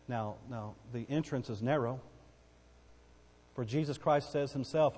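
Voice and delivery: ordinary delivery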